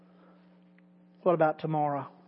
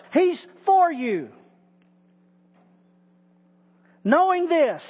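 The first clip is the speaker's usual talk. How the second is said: higher, impassioned preaching; preaching at full force; 6 semitones higher than usual. Acted. Preacher assuring listeners, impassioned preaching. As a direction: preach it with rising conviction.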